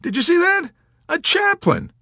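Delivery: very high, loud excitement; amazed exclamation. Acted. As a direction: squeal it in amazed delight. Dunbar marvels after the visit.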